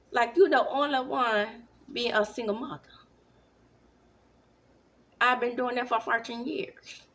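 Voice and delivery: loud mock Jamaican accent. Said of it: "My Jamaican accent".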